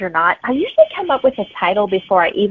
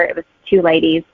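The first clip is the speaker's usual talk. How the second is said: ordinary talk, nothing marked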